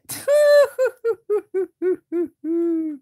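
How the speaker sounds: loud high mocking laugh; snorting laugh. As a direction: burst out snorting at him. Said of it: "guards snorted".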